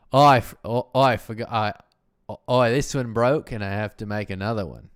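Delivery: attempted Australian accent, loud false starts. Shown as a demonstration. Obliging a request after the Canadian bit, tentative Australian accent. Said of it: "Can you do an Australian accent?".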